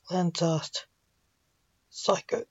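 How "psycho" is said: a soft unvoiced word through tears. A narrator's reading. Father confessing to his son, tearful whisper. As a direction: whisper it past the crying. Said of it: "Blake said through his tears".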